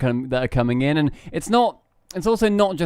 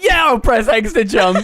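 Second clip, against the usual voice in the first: high, loud mock enthusiasm; pitched-up, laughing hype; hyped-up reply; 6 semitones higher, 5 dB louder than usual. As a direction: answer the game with over-the-top excitement. Acting out excited reply to game.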